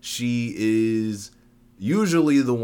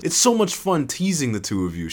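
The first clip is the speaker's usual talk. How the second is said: understated, higher-pitched teasing cry; gleeful cry; 7 semitones higher than usual. A narrator's reading. Teaser laughing at her two victims, mildly crying out. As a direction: cry it out with light glee. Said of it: "she cried".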